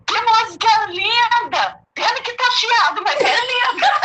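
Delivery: high, laughing through the quote. Retold or imitated. Retelling a stranger's praise of her song, laughing. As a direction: giggle out the man's praise of the song.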